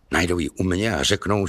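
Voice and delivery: ordinary delivery, nothing marked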